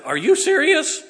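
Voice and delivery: high, loud disbelieving question; incredulous. Retold or imitated. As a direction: squeal it like a stunned bride-to-be. Daughter's reaction to proposal retold.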